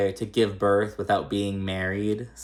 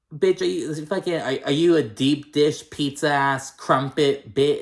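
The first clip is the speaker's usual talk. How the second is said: sassy mock comeback; 4 semitones higher than usual. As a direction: fire back at her like a drag queen.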